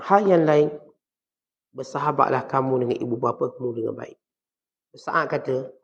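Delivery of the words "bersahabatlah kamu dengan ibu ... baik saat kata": ordinary talk, nothing marked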